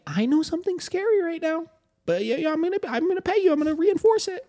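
a mocking sing-song falsetto for a frightened pup; Mickey Mouse voice. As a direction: coo at the dog like a cartoon mouse. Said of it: "give them the Mickey Mouse voice".

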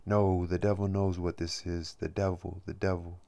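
ordinary delivery

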